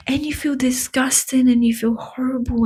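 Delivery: ordinary talk, nothing marked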